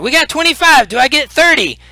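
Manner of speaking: high auction call; excited auctioneer. Acted. Goblin auctioneer calling bids.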